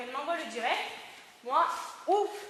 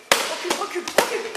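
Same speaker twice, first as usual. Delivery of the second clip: shouted command; 3 semitones higher, 8 dB louder than usual. Shown as a demonstration. Instructor replays the defence against a punch, shouted command. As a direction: shout it harder each time to drive them back. Demonstrated warning to an attacker.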